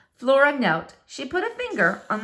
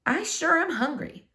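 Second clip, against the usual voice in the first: squirrel thought; 6 semitones higher, 4 dB quieter than usual. Acted. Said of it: "What he thought was".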